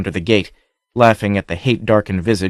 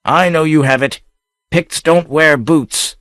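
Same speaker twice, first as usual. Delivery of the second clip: hidden anger; 7 semitones higher, 3 dB louder than usual. A narrator's reading. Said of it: "anger blazed blue in his eyes".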